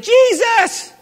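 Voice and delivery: high, sharp exasperated call of Jesus; upset cry. Retold or imitated. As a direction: call out in frustrated complaint. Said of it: "Martha got a little upset about it and said".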